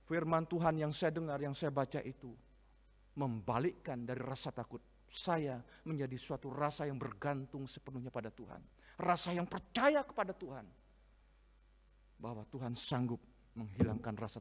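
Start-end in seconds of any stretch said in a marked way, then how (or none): none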